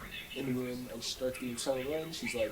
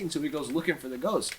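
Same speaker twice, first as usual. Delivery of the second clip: usual speaking voice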